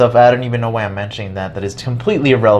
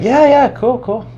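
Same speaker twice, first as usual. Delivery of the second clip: awkward agreeing; 13 semitones higher, 3 dB louder, slower than usual. Retold or imitated. Own reply retold.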